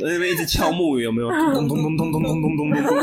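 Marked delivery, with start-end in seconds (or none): 1.3-3.0 wooden fish knocking. Retold or imitated.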